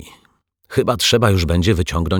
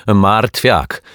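loud, higher, emphatic name; undeterred; 4 semitones higher, 7 dB louder than usual. A narrator's reading. Neighbour accepting the correction mid-complaint, undeterred. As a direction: take the corrected name and push on. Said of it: "kontynuowała niezrażona pani Majewska".

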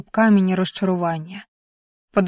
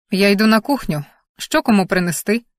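plain reading voice

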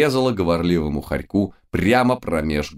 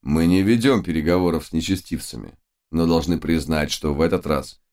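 usual speaking voice

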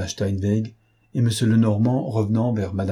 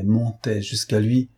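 plain reading voice

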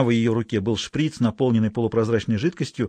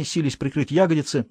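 ordinary delivery

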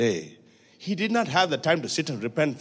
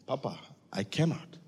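put-on soft, childlike plea to Papa with tears; tearful plea; 8 dB quieter than usual. Preacher retelling his prayer to God, soft and tearful. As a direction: ask Papa for help like a little child, softly. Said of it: "I say".

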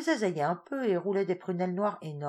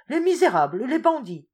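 much higher and louder, cursing; furious cry; 8 semitones higher, 10 dB louder than usual. A narrator's reading. A father cursing his daughter's kidnappers, furious. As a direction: spit out the insult in fury.

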